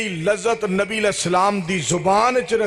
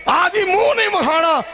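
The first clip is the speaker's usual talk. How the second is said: high, emphatic mimicked proclamation; mock announcement; 12 semitones higher, 3 dB louder than usual. Preacher imitating someone declaring the face must stay hidden, high mock proclamation. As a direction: call it out high, mocking the rule. Acts out the itikaf rule loudly.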